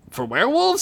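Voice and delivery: high-pitched questioning voice; Sid. A narrator's reading. Sid asks dying friend Duncan, high questioning voice. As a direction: ask with a high, rising lilt.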